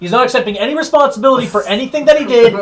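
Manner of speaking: high, loud in-character rant; mock yelling. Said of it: "Are you done yelling at me".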